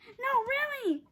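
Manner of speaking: put-on insistent little girl. A narrator's reading. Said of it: "said Annie".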